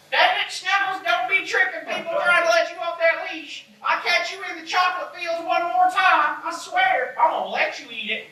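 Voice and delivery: put-on high-pitched old woman scolding a dog. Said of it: "an old woman".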